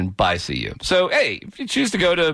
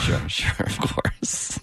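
laughing whisper; 5 dB quieter, much slower than usual. Real, not acted. Host answering through laughter.

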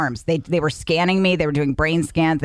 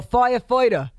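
put-on thick New York accent on "Firefighter", as "foya-foida"; New York fireman accent; 7 semitones higher than usual. Wife mimicking firemen's shared voice, New York accent. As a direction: imitate an FDNY voice, pitched up. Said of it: "a lot of them have that same voice".

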